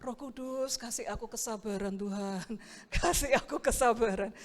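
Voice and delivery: soft, hushed plea for patience; silent inner prayer. Retold or imitated. Pastor praying inwardly for patience with the youth, hushed plea. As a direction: pray under your breath for patience. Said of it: "Dalam hatiku ya saya berdoa".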